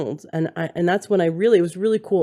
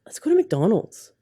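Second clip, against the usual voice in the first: higher-pitched imitation of a nagging little voice; inner voice; 4 semitones higher than usual. Imitating the voice in her head suggesting fast food, little tempting voice. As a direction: lightly voice the tempting voice in your head. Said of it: "the voice in my head said".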